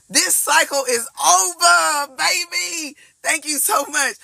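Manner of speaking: high, drawn-out, triumphant declaring; elated proclamation. Real, not acted. Reader tells viewers the cycle is over, high and elated. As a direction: proclaim it high, stretching the key words.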